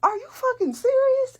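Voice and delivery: grandma can't believe what she hears; outraged disbelief. Retold or imitated. Grandma hearing the boyfriend cheated, shocked outrage.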